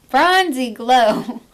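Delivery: emphatic slow announcement; loud slow correction. Real, not acted. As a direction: spell it out loud and proud. Corrects the slip loudly.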